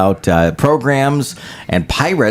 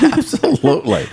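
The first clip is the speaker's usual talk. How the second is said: laughing it out. Real, not acted. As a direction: still laughing as you answer. Laughs at daughter joke.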